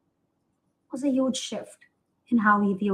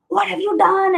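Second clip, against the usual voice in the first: higher, louder mock scolding yell; retold scream; 9 semitones higher, 6 dB louder than usual. Grandfather yelling at the fallen child, mock scream. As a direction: imitate an outraged telling-off, raised and loud. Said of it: "screaming, like".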